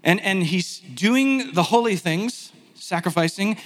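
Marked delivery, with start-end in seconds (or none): none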